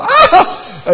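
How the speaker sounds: loud, high-pitched shriek; mock scream. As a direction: cry out in mock horror. Acting out people's shock at death.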